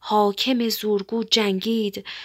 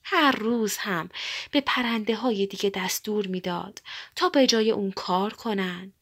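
plain reading voice

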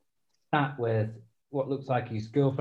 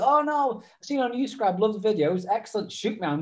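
high, chirpy fan voice; imitating a fan; 8 semitones higher than usual. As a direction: rattle off praise like a cheerful stranger. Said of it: "And he go".

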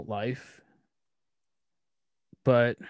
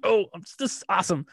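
delighted teasing; 9 semitones higher, 4 dB louder, faster than usual. Retold or imitated. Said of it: "And I'm like".

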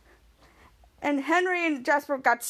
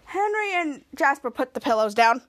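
ordinary talk, nothing marked

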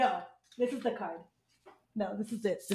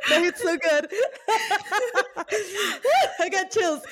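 high, louder laughter through the words; laughing with emotion; 10 semitones higher, 4 dB louder than usual. Real, not acted. To her co-host about the card, laughing and moved. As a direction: laugh out the chills.

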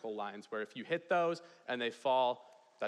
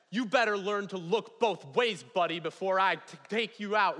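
loud, raised-pitch threat; retold angry yell; 6 semitones higher, 6 dB louder than usual. Mentor with a hatchet confronting the other driver, angry yelling. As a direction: yell a warning to scare him off. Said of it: "starts yelling at this guy".

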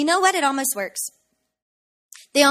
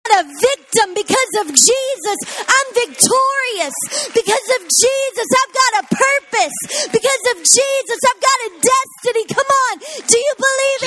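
loud, high, rising sermon call; full-force preaching; 10 semitones higher, 6 dB louder than usual. Acted. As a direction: rouse the congregation, building to a shout.

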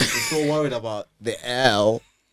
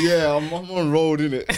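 mock roadman voice; 5 semitones higher, 12 dB louder than usual. Mocking an applicant's answer.

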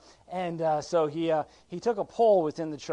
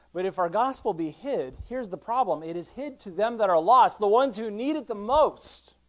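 raised voice, pitched up and insistent; building sermon fervor; impassioned preaching; 6 semitones higher, 8 dB louder than usual. Real, not acted. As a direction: preach it hard, stretching the key words. Preaches at full force.

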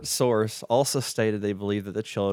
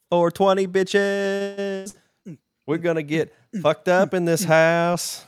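high, loud party demon; rowdy party demon; 9 semitones higher, 6 dB louder, slower than usual. Acted.

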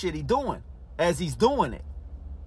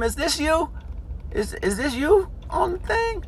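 put-on high, questioning girlfriend voice; girlfriend on the phone; 10 semitones higher, 3 dB quieter than usual. Girlfriend on the phone, suspicious, voiced high. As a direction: pitch up into her suspicious asking. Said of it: "she was like".

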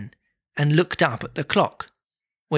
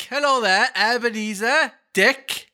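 high, Welsh-tinged hello; jovial Welsh; 8 semitones higher than usual. Retold or imitated. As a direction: redo the jolly Welshman greeting. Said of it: "he's like".

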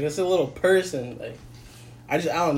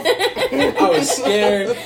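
very slow, laughing, louder; drawn-out laugh through the words; 5 semitones higher, 7 dB louder, much slower than usual. Real, not acted. Laughing about fear of fatherhood.

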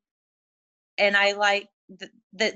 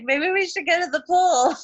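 higher, bright teasing quote of herself; playful mock suggestion; 8 semitones higher, 3 dB louder than usual. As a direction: toss it off like a cheeky idea. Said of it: "I'm like".

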